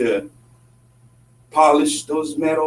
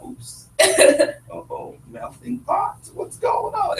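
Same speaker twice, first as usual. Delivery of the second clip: mock surprise; much slower than usual. Newcomer's shock at America.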